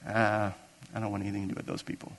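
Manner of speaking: low, quick, hushed brush-off; dismissive. Pretend. Someone looking down on a people, dismissive. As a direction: toss it away under the breath.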